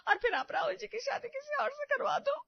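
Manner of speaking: high, loud theatrical sobbing; fake wailing. Daughter-in-law fake-cries to guilt mother-in-law, theatrical wailing. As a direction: wail it as a staged cry. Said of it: "छाती पीट पीट कर रोने लगती है".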